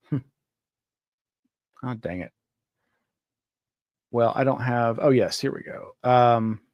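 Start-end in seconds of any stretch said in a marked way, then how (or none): 0.1-2.3 muttered frustration. Real, not acted. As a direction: mutter a mild curse under your breath. Cannot find what he wants.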